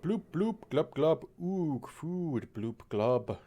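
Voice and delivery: hungry fish gurgle; gurgling fish man croak. Pretend. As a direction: bubble it out like a greedy fish. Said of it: "That's what I say when I'm hungry".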